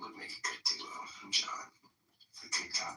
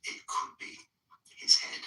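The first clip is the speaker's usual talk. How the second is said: lightly performed bedtime story reading; 6 semitones higher, 9 dB louder, slower than usual. Acted. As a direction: read it like a Dr. Seuss book to friends. Reads The Grinch aloud.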